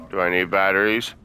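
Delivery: ordinary talk, nothing marked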